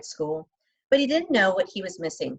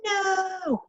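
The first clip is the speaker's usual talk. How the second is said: inner scream; 12 semitones higher than usual. A narrator's reading.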